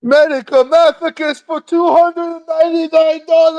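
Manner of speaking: high strained demon shriek; Exorcist demon voice. Acted. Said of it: "in the Ashton voice".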